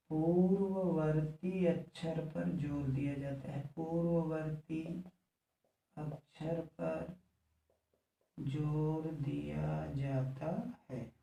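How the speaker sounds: word-by-word writing pace. Real, not acted. Repeats the rule slowly for writing.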